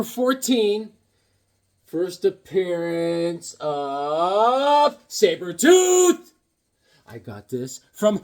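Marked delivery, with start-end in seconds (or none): 1.8-6.3 slow, drawn-out announcer reveal; trailer-voice reveal; dramatic reveal. Acted. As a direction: stretch it like a movie trailer.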